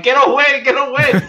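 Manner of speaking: high, loud retold panic; panicked yell. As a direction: shrink back from the actor, yelling. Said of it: "I'm like".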